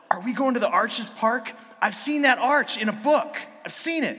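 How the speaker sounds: put-on high, loud burst of a child's excitement; excited kid. Son asks Dad about Arches Park, thrilled. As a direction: imitate a kid who can barely contain himself.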